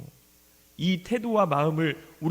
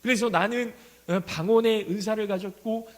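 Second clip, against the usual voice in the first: ordinary delivery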